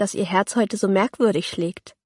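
ordinary delivery, nothing marked

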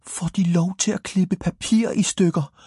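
put-on a small boy who can't believe his ears; high excited cry; amazed cry. A narrator's reading. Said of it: "råbte Frode".

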